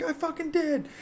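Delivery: high, strained shouted reply; mock call back. Medic calls back to the other gunner, high mock holler. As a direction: answer in a high, proud holler.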